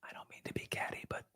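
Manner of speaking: gossipy whisper, voice gone; catty whisper. Real, not acted. Gossiping about Tina Fey's eyes.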